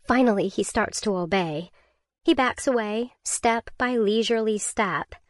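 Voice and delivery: ordinary delivery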